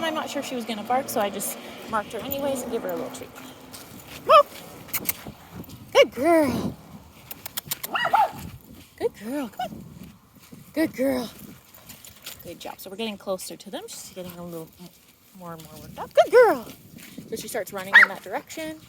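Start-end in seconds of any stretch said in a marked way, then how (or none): 4.3-11.3 high, encouraging praise; coaxing the dog. Real, not acted. Coaxing dog away from people. 16.1-16.7 high, encouraging praise; coaxing the dog. Real, not acted.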